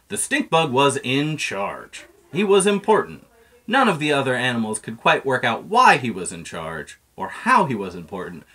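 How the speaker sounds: ordinary delivery, nothing marked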